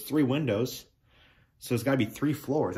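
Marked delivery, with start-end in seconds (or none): none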